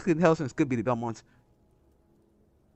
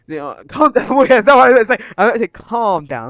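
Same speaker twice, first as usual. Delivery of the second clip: excited loud laugh; 7 semitones higher, 12 dB louder than usual. Real, not acted. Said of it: "Let me calm down".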